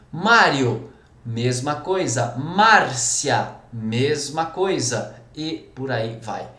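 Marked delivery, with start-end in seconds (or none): none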